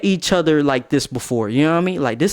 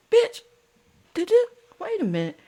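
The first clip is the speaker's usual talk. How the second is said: squeaky falsetto mock outrage; high-pitched outrage. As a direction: leap into falsetto, incredulous and offended. Acted outrage at the other girl.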